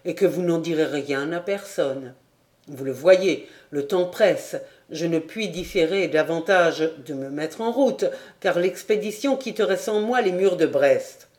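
ordinary delivery, nothing marked

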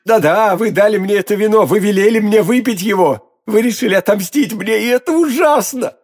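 high, anguished accusing cries; dying man's accusation. A narrator's reading. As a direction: wail it out at your poisoner. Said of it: "вскричал Бризмон".

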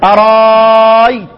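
أرايت with the alif held very long and steady; long madd. Shown as a demonstration. Teacher recites the replaced-hamza reading with long madd. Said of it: "هيقرأ إيه".

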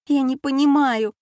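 высоко и жалобно; ноет в растерянности. A narrator's reading. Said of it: "жалобно сказала Алёна". (жалобно, чуть не плача)

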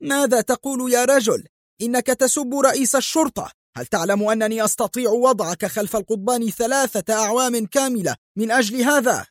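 police chief threatening his insulter, high furious yelling; furious scream. A narrator's reading. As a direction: explode in outrage and threaten. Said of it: "صرخ جوزيه في ثورة".

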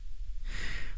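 brief, very soft snicker; a short laugh under the breath; villain's chuckle. Acted. Gang boss chuckles. (反派轻声一笑)

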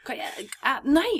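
urgent whispered shushing; hviskende hysj. Retold or imitated. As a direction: hush her so the guest won't hear. Prøver å tysse på venninnen. (hvisker hysj, stammende og panisk)

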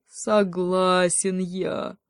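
very slow, drawn-out surrender; reluctant agreement. Acted. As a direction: give in, stretching each word. Волчонок нехотя сдаётся. (нехотя, протяжно)

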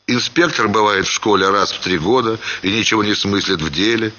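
plain reading voice

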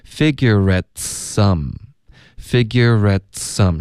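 slow "figurate some", a small t burst before "some"; teacher's slow repeated "figurate some"; popped t. Shown as a demonstration. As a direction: link "figurate some" slowly, releasing the t. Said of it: "这里要爆一个特的音".

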